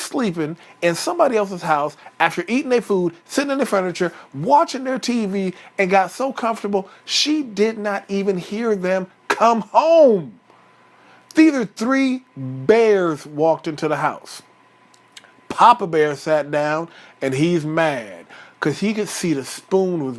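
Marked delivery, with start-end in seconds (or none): none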